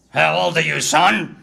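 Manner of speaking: put-on suspicious Australian driver questioning the boy; heavy Australian. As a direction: bark it in a broad Australian accent. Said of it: "in a very heavy Australian tone".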